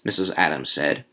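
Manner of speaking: ordinary delivery, nothing marked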